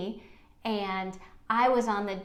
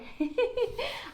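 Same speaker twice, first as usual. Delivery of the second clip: bright high giggle; a quick amused laugh before teasing; light laugh. Real, not acted. Host laughing at her own tease, light giggle.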